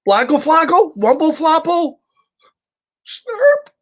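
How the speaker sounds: put-on high, loud toddler babble, last word squeaked. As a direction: babble like a frantic little girl. Toddler babbling to her dad.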